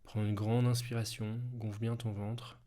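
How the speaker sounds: calm and unhurried, guide each breath; guided hypnosis. Acted. Leads a hypnosis breathing exercise.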